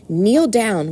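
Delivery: ordinary delivery, nothing marked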